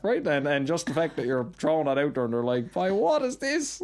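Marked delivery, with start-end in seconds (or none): 2.7-3.8 high, slow, puzzled "why, what is this"; high, drawn-out mock bewilderment; baffled question. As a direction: act totally lost by the phrase. Puzzled outsiders reacting to slang.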